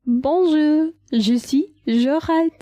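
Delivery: hammed-up French greeting; French accent. Shown as a demonstration. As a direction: introduce yourself like a Parisian. Trying accents for the name.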